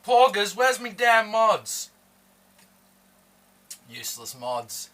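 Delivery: ordinary talk, nothing marked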